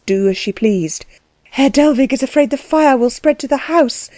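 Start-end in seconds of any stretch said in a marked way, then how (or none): 1.5-4.1 higher-pitched, mildly breathless report; a touch of anxious breathlessness. A narrator's reading. Anna telling the princess of the fire, breathless. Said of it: "she said breathlessly".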